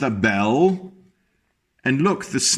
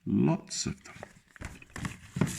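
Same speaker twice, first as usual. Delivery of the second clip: slow unvoiced whisper; hush, draw it out slowly; 11 dB quieter, much slower than usual. Real, not acted. Teacher whispering to children about the snails.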